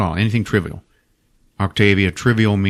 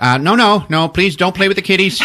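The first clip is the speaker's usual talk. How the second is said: pleading owner; 9 semitones higher, 4 dB louder than usual. Retold or imitated. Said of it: "Jenkins is like".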